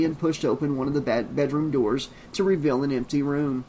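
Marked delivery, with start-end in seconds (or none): none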